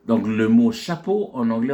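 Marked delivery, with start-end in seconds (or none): none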